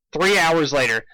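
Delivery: plain reading voice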